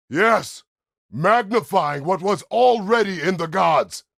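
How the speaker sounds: high, full-force roar; impassioned shout. Acted. Arguing about what was already in the gods, roaring shout. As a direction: bellow it out as a fierce declaration.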